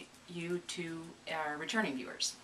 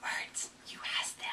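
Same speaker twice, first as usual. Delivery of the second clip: teasing whisper; 4 dB quieter than usual. Real, not acted. Teasing co-host's lost words.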